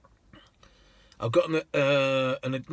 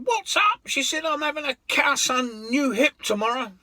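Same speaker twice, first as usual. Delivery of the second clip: much higher, indignant woman's voice; exasperated lady; 12 semitones higher than usual. Retold or imitated. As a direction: complain with disbelief. Lady voiced complaining of hip.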